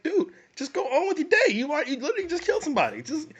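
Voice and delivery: high-pitched, laughing exasperation; exasperated mock advice. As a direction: plead in a squeaky high voice, half laughing. Mock advice addressed to film character.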